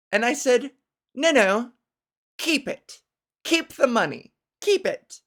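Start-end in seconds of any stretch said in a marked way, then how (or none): 1.1-5.2 high, grand queenly dismissal; regal. Retold or imitated. Telling the fan to keep the money, regal. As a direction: wave it away like a queen. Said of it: "I gave a regal twist of the wrist".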